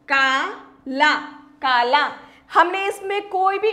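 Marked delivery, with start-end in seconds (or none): 0.1-2.1 का, ला said slowly and apart, then joined as काला; slow kaala. Shown as a demonstration. Teacher sounding out काला slowly for children. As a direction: drag out both long aa sounds. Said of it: "बोलने में बिल्कुल अलग हो जाएगा".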